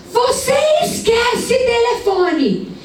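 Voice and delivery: mother yelling at her son over a school note; retold yelling mother. A mother on the balcony yelling at her son, high shrill scolding. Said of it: "E ela gritando com o menino".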